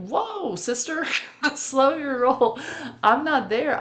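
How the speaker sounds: the horse Peppy telling Michelle to slow down, sassy and amused. Retold or imitated. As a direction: playfully rein her in as the old horse. Horse Peppy voiced in story.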